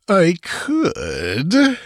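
high, teasing, drawn-out assurance; relishing. A narrator's reading. Priest dangles a cure before the dancer, gloating. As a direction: savour the listener's distress. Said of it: "assured her, in evident enjoyment".

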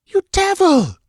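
high, loud outraged shout; furious cry. Acted. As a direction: hurl it at her sister in fury. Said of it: "cried Taramis".

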